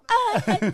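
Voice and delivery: high sharp scream; quick piercing yell of joy; short scream. Shown as a demonstration. Demonstrates her graduation scream.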